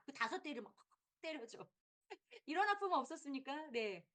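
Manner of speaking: normal talking voice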